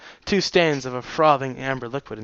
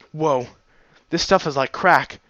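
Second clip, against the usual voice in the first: plain reading voice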